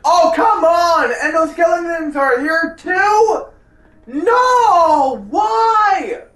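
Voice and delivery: high, loud panicked yelling; scared horror game streamer. Real, not acted. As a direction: shriek in frustrated fear. Skeletons appear, frustrated yelling.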